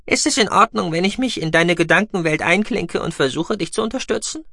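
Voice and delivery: high, squeaking mouse-beaver; squeaky voice. A narrator's reading. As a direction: pipe it out like a tiny creature. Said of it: "Guckis piepsende Stimme".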